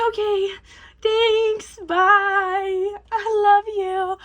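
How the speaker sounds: high, drawn-out singsong goodbye; mock goodbye. Odysseus bidding farewell before fleeing, mock high singsong.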